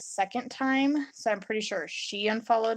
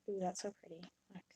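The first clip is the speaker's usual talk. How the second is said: whisper; 15 dB quieter, much faster than usual. Real, not acted. Admiring her work up close.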